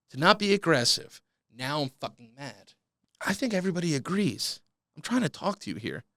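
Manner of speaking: ordinary delivery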